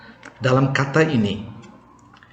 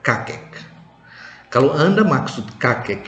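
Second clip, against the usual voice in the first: ordinary talk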